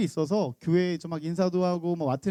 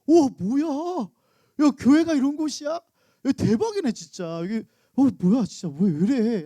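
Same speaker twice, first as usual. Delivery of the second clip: loud, high mock disbelief, slow start; shocked outburst; 5 semitones higher, 4 dB louder, slower than usual. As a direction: gasp at what you've just seen. Daughter shocked at church. (놀라서 높게 외치는 딸)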